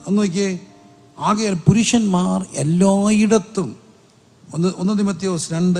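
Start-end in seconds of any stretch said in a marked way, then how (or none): 1.2-3.7 slow, raised preacher's reading; emphatic scripture quote. Real, not acted. Preacher repeats the verse to the congregation, slowly and emphatically. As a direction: draw out each key word of the verse.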